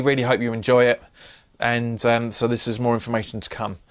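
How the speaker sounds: ordinary talk, nothing marked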